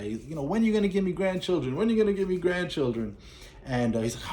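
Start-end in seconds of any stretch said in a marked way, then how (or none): 0.4-1.8 high-pitched, quick mock nag; a lightly hammed-up nagging mom; nagging mother. Mother pestering her son about grandchildren, high quick nag. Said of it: "the mother start messing with the big guy". 1.8-3.2 higher, quick mock nagging again at the same pitch; nagging mother repeated. Mother pestering her son about grandchildren, high quick nag. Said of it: "the mother start messing with the big guy".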